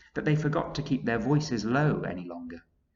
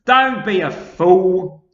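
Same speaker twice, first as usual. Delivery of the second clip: loud, slow, higher scoffing; scornful centipede; 9 semitones higher, 14 dB louder, slower than usual. A narrator's reading. Centipede scolding earthworm, loud scoff. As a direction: brush him off as the centipede. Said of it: "the centipede told him".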